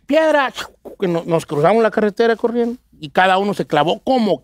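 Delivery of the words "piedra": shouted code word. Retold or imitated. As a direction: yell it as the signal to jump. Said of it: "el vato gritó la frase".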